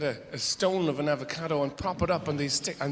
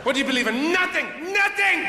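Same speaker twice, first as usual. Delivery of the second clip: shouted much higher and louder, defiant; proud non-believer; 10 semitones higher, 4 dB louder than usual. Acted. Westerner boasting he believes in nothing: defiant shout. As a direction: bellow it like a proud unbeliever.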